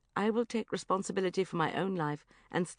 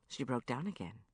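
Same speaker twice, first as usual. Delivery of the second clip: ordinary delivery, nothing marked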